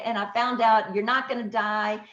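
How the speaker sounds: ordinary talk, nothing marked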